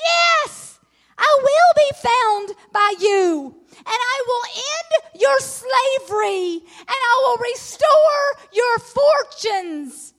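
high, loud and slow, near a shout with a crack of tears; God's promise cried out in the sermon's climax, slow and loud; excited God, crying out. Acted. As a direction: proclaim God's promise with rising fervour. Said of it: "he says it again".

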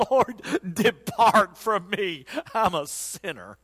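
slow, lightly voiced humble plea; kneeling Peter begging, slow and hushed; humbled Peter. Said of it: "Peter falls on his knees and said".

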